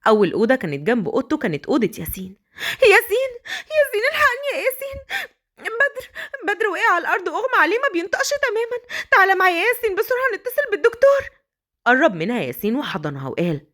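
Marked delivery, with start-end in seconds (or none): 2.8-11.2 much higher, fast frantic begging; breathless cry for help; panicked pleading. Acted. Begs Yassin for help. (توسل مذعور بصوت عالٍ وسريع)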